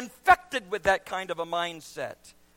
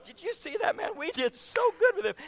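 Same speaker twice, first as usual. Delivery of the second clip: higher, gleeful self-congratulation; imagined proud aside; 9 semitones higher than usual. Acted. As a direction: brag to the Father. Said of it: "you're talking to the Father going".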